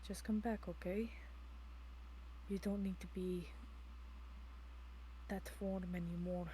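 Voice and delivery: hushed, low, soothing voice; tender reassurance. Acted. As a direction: softly coax a loved one back to safety. Soothing a transformed companion.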